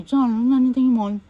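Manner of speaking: loud, quick called-out question; retold call. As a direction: call it across the room. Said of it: "They say".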